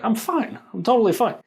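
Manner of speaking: high, protesting reassurance; high-pitched insistence. Retold or imitated. Injured kid brushing off his brother's friend, high insistence.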